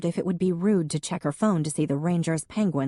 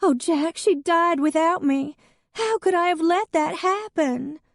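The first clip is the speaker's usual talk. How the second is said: high, louder, tearful wail; weeping Hadley; 13 semitones higher, 4 dB louder than usual. Acted. Hadley to Jack about her dead cat, weeping high. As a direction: wail it like the world has ended.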